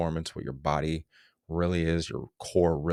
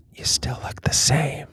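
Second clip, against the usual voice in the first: whisper; 9 semitones higher, 4 dB louder, slower than usual. Retold or imitated. Mocking a mirror-checking gym dude.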